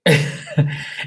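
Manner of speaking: sudden high breathy laugh burst; quick airy laugh. Real, not acted. Reaction to comment.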